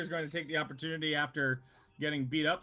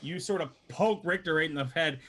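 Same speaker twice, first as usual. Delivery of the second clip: normal talking voice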